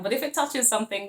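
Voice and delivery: usual speaking voice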